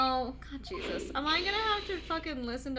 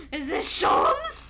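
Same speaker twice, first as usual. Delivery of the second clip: high, loud, drawn-out question; gasp, then squeal it upward; gasping excitement; 9 semitones higher, 9 dB louder, much slower than usual. Real, not acted. Streamer recognizing a favorite character, gasping excitement.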